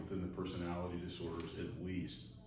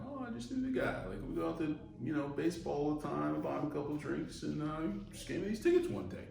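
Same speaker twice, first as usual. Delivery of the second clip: much higher, light and carefree; breezy casual guy; 7 semitones higher, faster than usual. Retold or imitated. Explaining to a friend how he got the seats, casual and high. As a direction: toss it off like it's no big deal. Imagined easygoing person explaining tickets.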